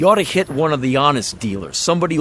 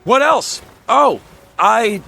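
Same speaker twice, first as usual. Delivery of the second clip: higher, startled echo of the question; caught off guard; 6 semitones higher, slower than usual. Acted. Salesman startled by the customers' question, caught-off-guard echo. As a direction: repeat it like you never expected it.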